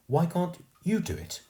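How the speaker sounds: put-on defiant posh girl refusing. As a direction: throw it back at him. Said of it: "she said defiantly".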